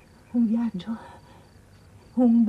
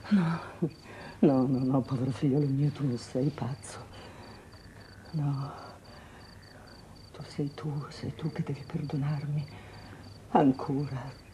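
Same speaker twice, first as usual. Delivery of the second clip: tenerezza; 3 semitones lower, 6 dB quieter than usual. Acted. Madre consola il figlio.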